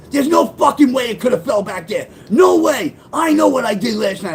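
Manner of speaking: high, loud shouting mom; imitating his mom's furious insistence; mother's angry denial. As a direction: yell it like she's certain.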